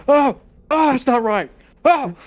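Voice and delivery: high, squealing disgusted oh; squeamish Rockwell. Retold or imitated. Rockwell shrinking from the tentacled woman, high squeamish squeal. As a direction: recoil in squeaky horror, half hooked.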